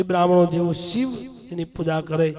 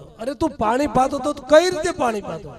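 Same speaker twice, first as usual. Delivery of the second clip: raised, higher, scolding a careless son; an old mother demanding an answer, voice pitched up; 8 semitones higher, 4 dB louder than usual. Acted. Mother scolds son over dry garden. (ઠપકો આપતી માનો ઊંચો અવાજ)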